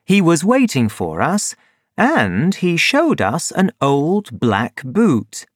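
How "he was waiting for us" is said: ordinary delivery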